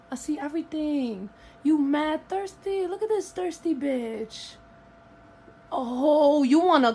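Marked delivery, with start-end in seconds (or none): none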